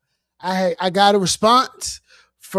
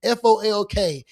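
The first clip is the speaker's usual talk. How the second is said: ordinary talk, nothing marked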